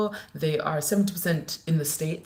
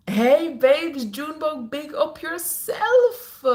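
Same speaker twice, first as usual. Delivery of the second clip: higher and louder, the yourself drawn out slowly; sing-song shout-out; 9 semitones higher, 5 dB louder, much slower than usual. Real, not acted. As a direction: hail the viewer by name like a DJ. Shout-out to a viewer.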